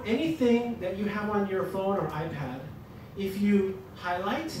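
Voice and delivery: ordinary talk, nothing marked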